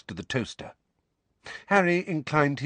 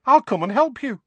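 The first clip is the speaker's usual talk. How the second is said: loud, very high, cheery offer; bright; 7 dB louder than usual. A narrator's reading. Young witch offering to help Harry pack, bright. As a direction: chirp it brightly, eager to help. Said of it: "said Tonks brightly".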